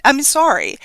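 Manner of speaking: usual speaking voice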